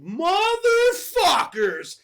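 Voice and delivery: high, long drawn-out curse; mock outraged cry. Voicing Stripe as his gremlins are set on fire, outraged howl. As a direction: stretch it into a hammed-up villain howl.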